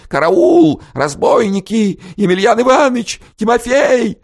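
put-on high, loud, panicked old woman's cries; old woman crying for help. A narrator's reading. Old woman flees the intruders screaming for help, high and loud. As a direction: shriek for help like a terrified old crone. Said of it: "Старушенция бежала прочь".

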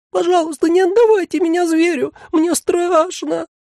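squeaky creature begging, scared; plaintive creature. A narrator's reading. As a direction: plead pitifully, terrified. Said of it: "жалобно твердил сиреневый манекен".

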